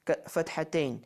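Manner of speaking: normal talking voice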